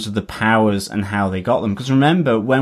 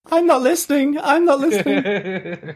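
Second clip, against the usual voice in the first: high-pitched creature impression; Gollum. Co-host breaks into an impression for the others, high Gollum voice. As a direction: squeal it in a high, creaturely whine. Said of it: "Can I do my Gollum impression".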